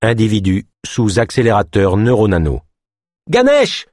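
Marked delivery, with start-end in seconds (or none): 3.3-3.9 very high, loud cry of the name; cry for help. A narrator's reading. Ava, seized by thugs, cries to Ganesh for help. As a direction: scream his name for help. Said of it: "cria Ava".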